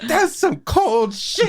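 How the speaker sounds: high, slow, laughing outrage; hammed-up offended son; mock indignation. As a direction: drag it out in wounded disbelief. Son's indignant reply to mother, retold.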